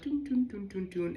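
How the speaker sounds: drum rhythm imitation. Snake moving to the ceremony drum, voiced drumbeat. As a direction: hum the drum beat as the snake moves. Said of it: "and I'm like".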